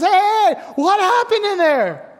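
calling out. Retold or imitated. Daughter calling to the boys down the driveway, loud. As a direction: shout after them before they get away.

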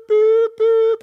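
high, loud beeping like an alarm clock; imitated alarm beep. Preacher imitating the morning alarm clock, high and loud. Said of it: "you hear this boop".

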